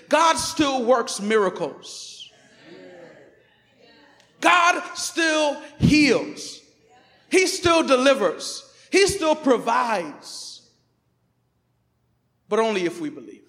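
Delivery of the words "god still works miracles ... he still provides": slow, loud, each word hammered down; emphatic declaration. Acted. Pastor proclaiming to the church, slow and emphatic. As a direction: proclaim it like a shout of faith. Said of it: "I wanna say this emphatically".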